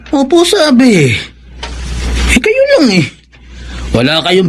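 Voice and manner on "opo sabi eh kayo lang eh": higher, cocky boast to his father; cocky brag. Acted. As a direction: show off, pleased with himself. Son bragging to doubting father.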